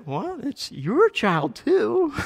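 high-pitched, drawn-out disbelief; incredulous retort. Acted. Parent answering "he's your child", incredulous. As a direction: throw it back slowly, pitch jumping up.

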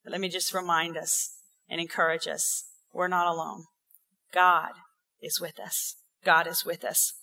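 soft, low and tender; hushed encouragement. Real, not acted. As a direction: lean in and comfort them quietly. Softens to encourage the congregation.

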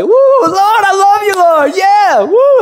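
very high, loud whooping praise; jumping and shouting praise in the living room; ecstatic worship shout. Retold or imitated. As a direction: holler to the Lord at full joy. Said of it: "and I'm just like".

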